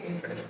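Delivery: ইন্টারেস্ট said as int-rest; dropped vowel. Shown as a demonstration. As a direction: say ইন্টারেস্ট in two syllables. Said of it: "ভাওয়ালটা drop করলে হয় কি?".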